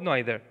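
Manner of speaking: "neither" starting like "nigh"; NYE-ther pronunciation. Shown as a demonstration. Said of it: "اینجا گفت".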